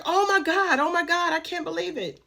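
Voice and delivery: high-pitched mock scream, no real shouting; retold scream. Aunt seeing the bed on coming home, mock scream. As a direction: imitate a shrieking aunt without much volume. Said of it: "she screams".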